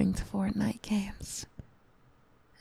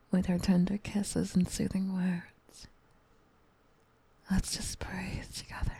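ASMR whisper. Acted. Mommy comforting her upset gamer sweetie, tender whisper. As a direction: whisper close to the mic like a soothing mommy.

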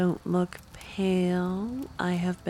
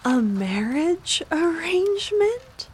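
high startled question; shocked discovery of betrayal; 8 semitones higher, 4 dB louder than usual. Acted. Discovers marriage plan, +8 +4.

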